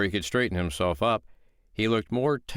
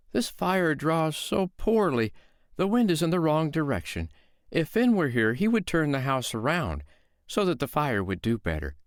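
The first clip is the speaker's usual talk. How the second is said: mild, higher, innocent housewife voice; sweet-voiced woman; 7 semitones higher than usual. Foster mother calmly lying to the giant, innocent tone. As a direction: play the calm mother who is fooling the giant.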